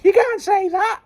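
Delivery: shrill high mock protest; mock outraged squeal. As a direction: squeal it in fake shock.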